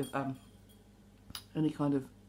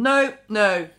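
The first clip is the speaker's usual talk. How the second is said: louder, emphatic refusal; brain's refusal; 3 semitones higher, 6 dB louder than usual. Acted. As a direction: voice your brain firmly saying no. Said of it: "almost like my brain's gone".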